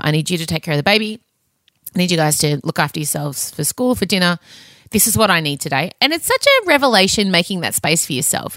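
usual speaking voice

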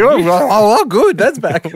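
high, loud mock delight; surprised viewer. Imitating a viewer delighted at a TV promo, high mock delight. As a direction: gush like a thrilled viewer. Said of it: "rare to hear the promo go".

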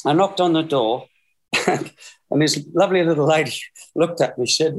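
ordinary talk, nothing marked

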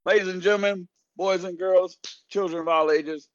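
ringmaster. Acted. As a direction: call the crowd in like a circus host. Circus style opening.

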